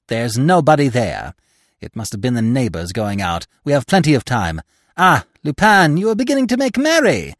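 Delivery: ordinary delivery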